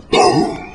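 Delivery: unvoiced-breath booming blast; drawn-out mouth-made boom; imitated explosion. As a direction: mimic a rig blowing up with your breath.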